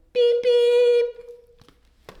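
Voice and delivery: high, loud honking beeps; happy horn beeps. Acted. Blue honking happily to his friends, loud high beeps. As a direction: blast the horn with joy. Blue's happy final honk.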